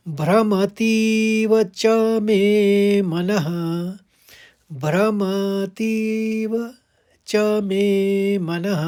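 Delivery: भ्रमतीव drawn out, च मे मनः slow; slow recitation. Shown as a demonstration. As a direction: chant the quarter slowly. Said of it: "चौथे चरण को कैसे उच्चारित करेंगे". (धीमा उच्चारण)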